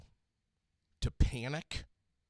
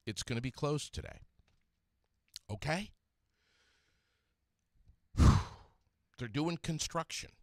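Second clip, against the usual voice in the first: ordinary talk, nothing marked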